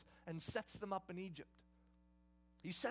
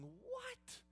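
much higher, sputtering astonishment; shocked brothers stammering, high; stunned disbelief; 11 semitones higher than usual. Retold or imitated. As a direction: gasp out the confusion, pitched way up.

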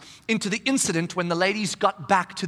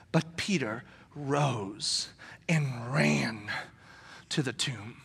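slow, soft and low; hushed dramatic telling; 8 semitones lower, 7 dB quieter, much slower than usual. A narrator's reading. Preacher slowly telling of Peter running to the tomb, hushed. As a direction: draw it out in a hush.

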